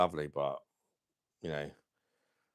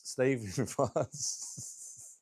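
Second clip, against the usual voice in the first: breaking into real laughter mid-question; laughing; 3 semitones higher, 3 dB quieter, much slower than usual. Real, not acted. Streamer teasing a viewer about France, laughing. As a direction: crack up as you get the words out.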